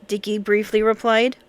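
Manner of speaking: ordinary delivery, nothing marked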